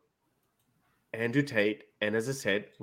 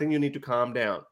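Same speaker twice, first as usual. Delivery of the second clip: usual speaking voice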